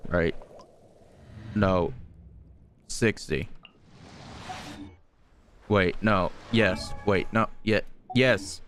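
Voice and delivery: ordinary delivery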